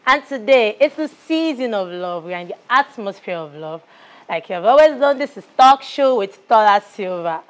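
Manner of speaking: bright-pitched, emphatic TV presenter opening; loud, measured host announcing the show; talk show host intro. Acted. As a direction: greet viewers with a big, projected show welcome.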